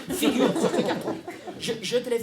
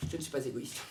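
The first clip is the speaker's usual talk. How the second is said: low, very soft reply; contained denial; 8 semitones lower, 12 dB quieter than usual. Acted. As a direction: deny it under your voice, held back. Mother's first, contained denial. (dénégation contenue, tout bas)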